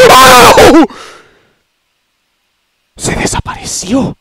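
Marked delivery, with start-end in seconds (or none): 0.1-0.5 loud sudden shout of fright; startled shout. Real, not acted. Sudden scare.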